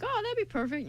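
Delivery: usual speaking voice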